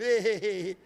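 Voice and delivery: a hammed-up chuckle; mock laughing. Older church members laughing along at the joke. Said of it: "ah, tá tudo rindo".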